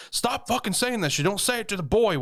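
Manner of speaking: high, urgent mock scolding; retold frantic order to shut up; agitated hushing. Uncle stopping aunt telling the boy, agitated.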